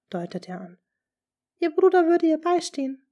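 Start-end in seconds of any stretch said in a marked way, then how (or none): 1.6-3.1 stammering. A narrator's reading. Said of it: "stammel ich".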